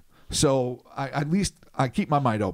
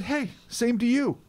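naive reply; 10 semitones higher than usual. Retold or imitated. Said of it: "And I said".

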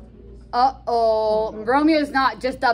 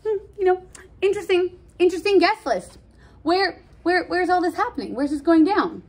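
much higher, fake-casual musing; playing it cool; 9 semitones higher, faster than usual. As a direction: act like you barely care. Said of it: "trying to play it cool".

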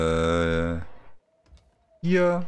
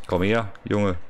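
ordinary talk

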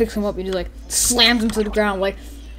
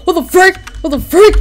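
higher, louder startled outburst; mock alarm; 9 semitones higher, 9 dB louder than usual. Player acting out a character who sees a bomb, startled. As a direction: burst out in pretend disbelief.

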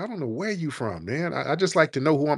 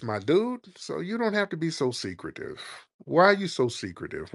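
normal talking voice

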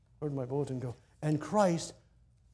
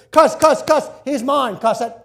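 much higher, loud gloating devil; Satan cussing; 9 semitones higher, 17 dB louder, slower than usual. Acted. Satan gloating over Moses, loud and high. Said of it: "And Satan cussed".

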